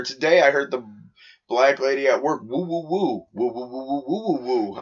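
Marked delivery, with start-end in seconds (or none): 2.4-4.8 soft quick repeated woo imitation; mimicking woo woo. Retelling a coworker at work, soft mimicked woos. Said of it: "I'm like, "Damn, with the woos".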